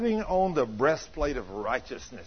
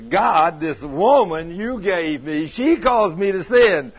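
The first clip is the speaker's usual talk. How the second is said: louder, slow accusing whine to God; whiny blaming complaint; 7 dB louder, slower than usual. Retold or imitated. Preacher mocking Adam's blame on God, louder whine. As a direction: play Adam passing the blame, louder. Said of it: "Adam says".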